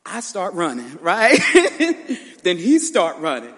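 laughing as she remembers running from the furnace blast; laughing through it. Real, not acted. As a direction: tell it while you can't stop laughing. Laughing while retelling the explosion.

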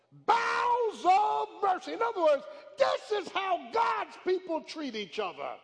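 slow, high, shouted sermon climax; full-force preaching. Real, not acted. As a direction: pastor whooping at the church about family.

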